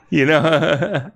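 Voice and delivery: amused, laughing as you say it. Real, not acted. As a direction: let the words break into real giggles. Laughing at own bug men joke.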